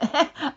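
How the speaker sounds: loud sudden laugh; a real laugh bursting out; burst of laughter. Real, not acted. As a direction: laugh out hard. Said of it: "I couldn't stop laughing".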